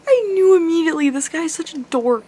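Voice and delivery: high, loud squeal sliding down; giddy gushing. Real, not acted. Giddy gushing about her crush.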